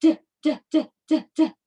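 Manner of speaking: short, separate "d" taps; knocking letter sound. Shown as a demonstration. Teacher asking which letter this is, rapped-out "d" sounds. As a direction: repeat "d" like knocks on a door. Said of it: "What letter says".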